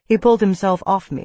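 ordinary delivery, nothing marked

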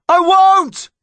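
high, frantic yell; yelling back. A narrator's reading. Connor answering his slipping mother, frantic yell. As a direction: shout it back desperately. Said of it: "Connor yelled back".

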